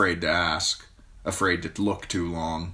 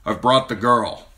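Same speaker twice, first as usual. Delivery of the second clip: knight; 5 semitones higher, 7 dB louder than usual. A narrator's reading. Knight presenting Sansa to queen.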